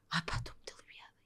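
soft relieved whisper; whispered relief of a friend after crying. Retold or imitated. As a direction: breathe it out as a whisper. Relieved friend answering softly.

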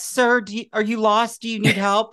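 lightly acted worried passer-by; mock concerned helper. Imagined helpers ask a tongue-tied fan if lost, mock concern. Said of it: "They'd be like".

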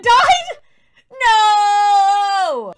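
loud, high mock-anguished no; anguished shout. Widow learning husband died, hammed-up anguished shout. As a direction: cry out in hammed-up grief.

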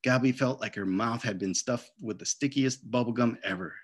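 ordinary delivery, nothing marked

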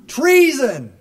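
high, very loud single-word shout; shouted answer. Real, not acted. Speaker answering his own question to the audience, shouted. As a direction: bellow it to the crowd.

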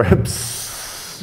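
imitated sudden "psst" of escaping air; sharp unvoiced burst of hissing air; air hiss of a tire. Driver describing a bald spare about to blow, imitated hiss. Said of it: "the thing would just go".

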